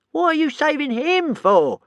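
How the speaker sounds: very high, slow whiny dragon; complaining dragon. A narrator's reading. Toothless complaining to Hiccup about saving Alvin, whiny. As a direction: whine it like a peevish pet. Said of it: "complained Toothless".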